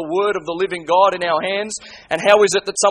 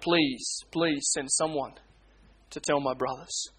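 softer, hushed begging, please please send someone; 3 semitones lower, 8 dB quieter, slower than usual. Retold or imitated. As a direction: let the plea fade to a near hush. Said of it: "as he calls out".

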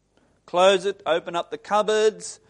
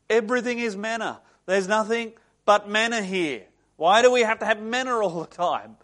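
exasperated complaint; 5 semitones higher, 5 dB louder than usual. Retold or imitated. Acted Israelites complain about manna.

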